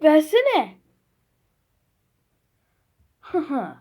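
ordinary talk

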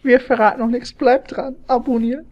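high, hammed-up channel plug; mock YouTuber outro. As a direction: parody a YouTuber begging for subs. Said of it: "Spaß".

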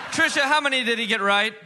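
ordinary delivery

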